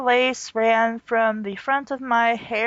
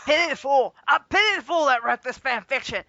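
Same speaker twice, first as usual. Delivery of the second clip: Mister T impression; 3 semitones higher than usual. Mock Mister T voice.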